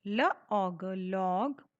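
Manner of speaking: very slow letter by letter blending into लॉग; slow sounding out. Shown as a demonstration. Teacher blends the word for children, very slowly. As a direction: stretch out ल, ऑग, then say लॉग. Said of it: "जिसे हम बोलते हैं लॉग".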